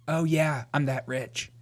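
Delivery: ordinary delivery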